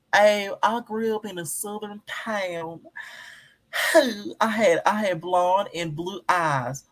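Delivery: over-the-top mock Southern twang; fake country accent. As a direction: lay on a put-on Southern drawl to mock her. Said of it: "puts on this thick country accent".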